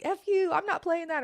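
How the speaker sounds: imitating a kid cussing out a teacher, high and mocking; mocking defiant kid. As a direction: toss it off like a sassy teenager. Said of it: "kids are like".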